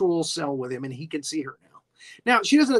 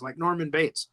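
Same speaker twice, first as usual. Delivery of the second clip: ordinary talk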